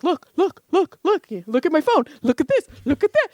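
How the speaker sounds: put-on high, insistent child's pestering; mock child nagging to look at his phone; whiny kids. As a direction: badger her like an impatient kid. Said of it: "it's always like".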